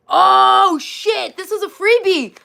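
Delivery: high, loud excited squeal; surprised. Real, not acted. Opening a birthday package for viewers, surprised and excited.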